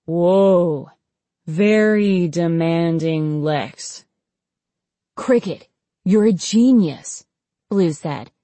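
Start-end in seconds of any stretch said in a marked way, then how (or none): 0.0-4.0 slow, dazed, stretched-out words; woozy. Acted. Patient baffled by his own feet, woozy. 5.2-7.2 higher-pitched delighted wonder; amazed praise. Acted. Friend praising the experimenter after the cure, amazed.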